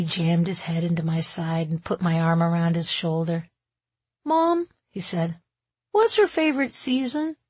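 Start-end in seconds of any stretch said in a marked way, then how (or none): 4.2-4.7 put-on high-pitched little boy; young son. A narrator's reading. Boy calling to his mom, childlike. Child figure in narrated story. 5.9-7.4 put-on high, curious little boy; young son. A narrator's reading. Boy asking his mom a question, childlike. Child figure asks mother.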